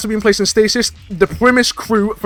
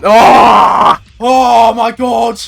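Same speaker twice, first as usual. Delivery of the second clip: relieved shout; 4 semitones higher, 6 dB louder, much slower than usual. Real, not acted. Relief after winning fight.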